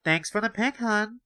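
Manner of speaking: put-on high, breezy Valley Girl; lightly voiced Valley Girl. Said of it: "Valley Girl voice".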